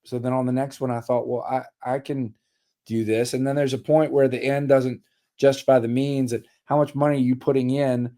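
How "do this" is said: ordinary talk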